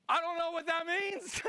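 high, panicked bewilderment; freaking out. Retold or imitated. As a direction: wail it in mock panic. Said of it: "I'm freaking out".

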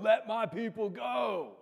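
mock epic cry to let the people go; movie Moses. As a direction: boom it out like the movie. Quoting the movie Moses line.

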